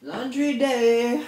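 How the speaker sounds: high, slow announcement; drawn-out high announcer; sing-song title. Pretend.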